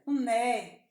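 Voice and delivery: normal talking voice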